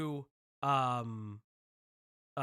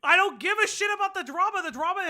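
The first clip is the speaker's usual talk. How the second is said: loud, higher exasperated outburst; fed-up streamer shouting, higher and louder; 6 semitones higher, 8 dB louder than usual. Real, not acted. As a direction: yell it, fed up with the drama. Streamer exasperated at sped-up video.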